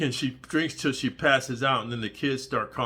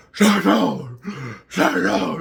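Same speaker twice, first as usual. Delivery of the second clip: loud high bark of shut down; shouting; 5 semitones higher, 8 dB louder, much slower than usual. Acted. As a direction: yell it out loud. Comic shout during story.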